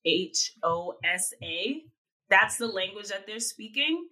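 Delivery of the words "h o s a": slow, each letter said separately; spelled out letter by letter. Shown as a demonstration. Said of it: "but I'm gonna spell it".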